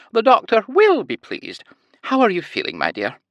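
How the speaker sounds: high sing-song Scottish lilt, leaning on words; sweet high nurse voice. A narrator's reading. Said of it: "a heavy Scottish accent and a high-pitched sing-song voice".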